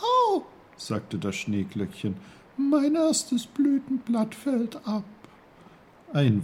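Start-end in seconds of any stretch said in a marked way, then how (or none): 0.0-0.4 sudden very high squeak of pain; a tiny flower yelping; little snowdrop's ouch. Acted. The snowdrop yelps when the uncle nudges her stem. 2.6-5.1 a tiny snowdrop speaking gently. Acted. The snowdrop answers Uncle Pfefferkorn in a high little voice.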